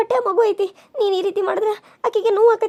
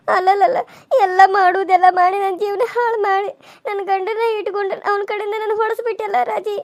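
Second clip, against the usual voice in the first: weeping lament. Acted. Wife weeps after beating.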